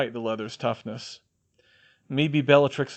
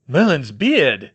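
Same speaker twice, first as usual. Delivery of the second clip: shocked gasp, slow; 2 semitones higher, 9 dB louder, slower than usual. A narrator's reading. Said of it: "Arthur gasped".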